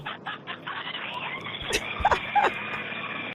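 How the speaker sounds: long high burst of laughter; real high-pitched laughing fit; helpless laugh. Real, not acted. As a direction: crack up, high and unrestrained. Cracks up at the landing.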